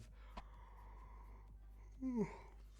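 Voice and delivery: long high breathy yawn swallowing the words; yawning mid-tutorial just after waking up; big real yawn. Real, not acted. Tired tutor stretches into a long, high, unvoiced yawn. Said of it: "I'm yawning".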